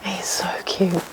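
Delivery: soft whispered aside. Real, not acted. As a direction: whisper it fondly. Unvoiced whisper.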